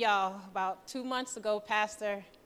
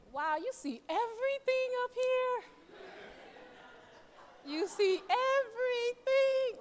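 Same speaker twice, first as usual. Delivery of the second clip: awed surprise; 12 semitones higher than usual. Real, not acted. Nervous speaker at the pulpit, looking out, awed. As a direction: marvel at seeing the whole room from up here.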